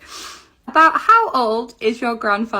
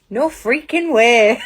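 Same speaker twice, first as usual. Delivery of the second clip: amazed laughing disbelief; slower than usual. Real, not acted. Amazed slow laughing exclamation.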